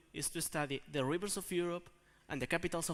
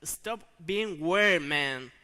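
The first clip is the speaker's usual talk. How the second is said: loud, slowed-down "weird"; exasperated interruption; 8 dB louder, much slower than usual. Acted. Student stopping a friend's singing, exasperated. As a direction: cut him off, exasperated.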